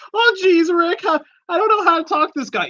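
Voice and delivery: high, fast, nervous cartoon teen; Morty impression. Retelling a call to a salesman, in a high nervous teen voice. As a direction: stammer it out like a panicky kid. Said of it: "So I did my Rick and Morty voice".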